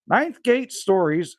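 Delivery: ordinary talk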